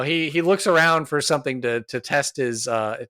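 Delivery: ordinary talk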